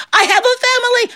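high, panicked pleading; pinned man begging for his life; desperate plea. Acted. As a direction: beg in a sudden high squeal.

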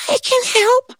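very high, tiny squeaky ant; a wee ant piping up. Acted. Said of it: "this tiny little voice".

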